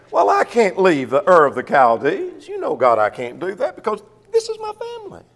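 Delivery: Abraham pleading, voice rising up high; a hint of a whiny Abraham who won't leave home; reluctant Abraham. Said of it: "Can you imagine Abraham saying".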